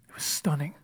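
hushed, breathy whisper of awe; awed whisper. Real, not acted. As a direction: whisper it, stunned. Awed hush after the cross.

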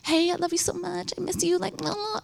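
gushing sweet text voice. Retold or imitated. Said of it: "and I'm like".

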